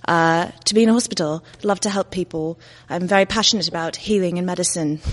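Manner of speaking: put-on Indian-British woman's polite English accent. Introducing herself to all of you as a Londoner, British accent. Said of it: "version of me that could have settled in the U K".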